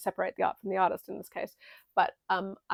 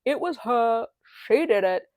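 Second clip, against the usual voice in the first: louder, pointed blame; accusing; 4 semitones higher, 7 dB louder than usual. Retold or imitated. Nessarose blaming Elphie to Bok, loud accusation. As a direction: shout out who's guilty. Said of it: "Nessarose is like".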